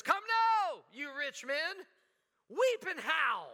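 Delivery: booming high prophet's cry, stretching weep and howl; Old Testament prophet. Retold or imitated. Preacher voicing James the prophet to rich men, booming. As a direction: thunder it like a prophet on a stump. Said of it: "he sounds like an Old Testament prophet".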